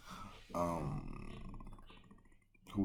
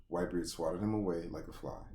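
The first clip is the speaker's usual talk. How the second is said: ordinary talk, nothing marked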